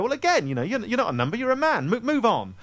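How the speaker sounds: flustered high voice; dodging it, pitch up; high-pitched evasive bluster. Retold or imitated. Evasive reply, much higher pitch.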